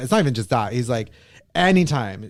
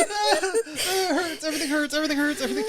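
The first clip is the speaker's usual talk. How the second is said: high, soft laughing whimper; weak, squeaky plaint from laughing too much; laughing till crying; 12 semitones higher, 5 dB quieter than usual. Real, not acted. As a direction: whimper it through exhausted real laughter. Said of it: "I'm laughing so hard".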